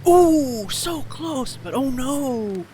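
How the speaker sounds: high, loud ooh of dismay; excited groan. Real, not acted. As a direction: react to a near miss, big and high. Ball nearly goes in.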